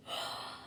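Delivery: sharp breathy gasp; mock gasp of the crowd. A narrator's reading. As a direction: gasp in shock. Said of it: "the crowd gasped".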